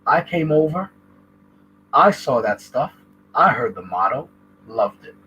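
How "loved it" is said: ordinary talk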